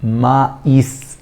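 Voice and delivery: ما إيس drawn out, with a gap between ما and إيس; split, very slow. Shown as a demonstration. Teacher to students, modelling ما إيس slowly. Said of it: "في وقفة كده صوتية".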